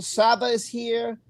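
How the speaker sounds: ordinary talk, nothing marked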